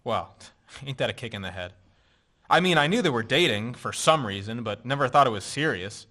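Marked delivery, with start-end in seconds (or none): 0.0-1.7 rueful laugh. Acted. Bitter laugh at news.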